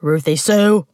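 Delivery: a meaner, restrained yell; yelled name, moderately voiced. A narrator's reading. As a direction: call the name like summoning a dog. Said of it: "he yelled alongside Hamish".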